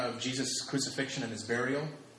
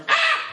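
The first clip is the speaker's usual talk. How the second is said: loud, high "ah" of alarm; mock startled yell; 9 dB louder than usual. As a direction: yelp as if freaking out. Imitates townsfolk freaking out at leper.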